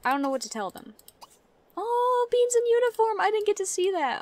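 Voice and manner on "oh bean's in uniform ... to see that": high, loud delighted squeal; thrilled to spot him; excited squeal. Real, not acted. Gamer spotting her Sim in uniform, squealing with delight.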